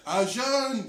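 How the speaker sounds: high-pitched mock French accent. French girl scolding him in his retelling, exaggerated French accent. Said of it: "this is a French accent".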